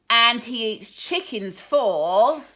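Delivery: slow, loud menacing tease; rude taunt. Acted. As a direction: draw out the threat to the hen. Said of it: "even more rudely".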